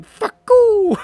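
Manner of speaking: put-on high, loud shrieking kid; child yelling. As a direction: shriek it like an angry toddler. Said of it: "like, a child saying".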